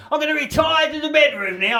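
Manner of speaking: put-on high, prim girlfriend voice; imitating girlfriend. Mocking his girlfriend announcing bedtime, high and prim. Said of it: "She goes".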